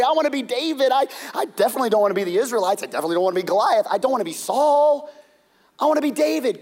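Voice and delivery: eager listener. Acted. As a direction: answer like an eager kid. Congregation voiced wishing.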